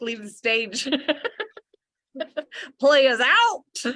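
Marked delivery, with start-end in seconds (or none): 2.8-3.9 hammed-up sign-off. Mock stage exit after mic drop.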